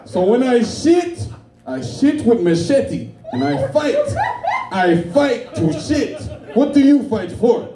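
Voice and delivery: put-on slow, high African-accented storytelling; African dad accent. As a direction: imitate an African father, drawn out and emphatic. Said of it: "I got an African dad too".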